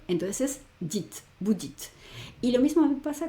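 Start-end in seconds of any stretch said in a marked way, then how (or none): 0.8-1.1 "dit" closed on a sounded T; silent final E. Shown as a demonstration. Teacher explains where to stop, "dit" ending on T. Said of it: "No la pronuncio". 1.4-1.9 "vous dites" stopping on the T; silent final E. Shown as a demonstration. Teacher sums up the rule, "vous dites" without the E. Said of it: "No la pronuncio".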